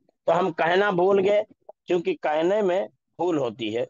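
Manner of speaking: normal talking voice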